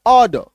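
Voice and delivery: ऑर्डर as "aw-duh", no R sounded; silent R. Shown as a demonstration. Teacher giving the correct sound, ऑर्डर with silent R. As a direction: drop both R's in ऑर्डर. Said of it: "इसका उच्चारण होता है".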